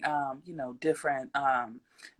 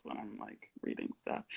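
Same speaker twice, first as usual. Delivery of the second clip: whisper; 16 dB quieter than usual. Real, not acted. Unvoiced aside.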